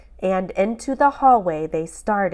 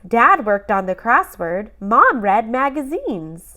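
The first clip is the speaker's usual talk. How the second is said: usual speaking voice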